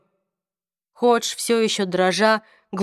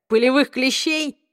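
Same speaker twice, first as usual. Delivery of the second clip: mocking; 6 semitones higher than usual. Mocking Hodge's fear.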